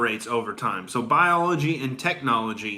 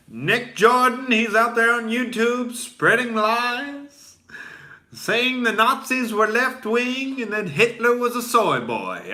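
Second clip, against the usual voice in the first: high, slow mocking whine; whiny critic voice; 9 semitones higher than usual. Mocking a critic who calls him a liar, high slow whine. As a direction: sneer it like a tattling critic.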